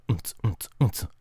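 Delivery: hushed little beatbox thumps; soft, low mouth percussion; very quiet. Shown as a demonstration. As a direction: keep the beat right down low. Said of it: "it's very, it's very quiet, that part".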